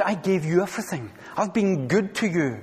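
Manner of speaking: God gently reproaching David, soft and tender; tender appeal. Acted. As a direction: plead softly like a wounded parent. God's hurt appeal voiced.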